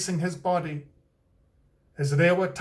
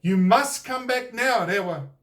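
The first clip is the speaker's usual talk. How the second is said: loud, higher commanding call; stern call upward; demanding; 5 semitones higher, 4 dB louder than usual. A narrator's reading. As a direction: order him down from the sky. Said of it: "demanded the tūhunga".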